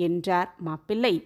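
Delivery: ordinary delivery, nothing marked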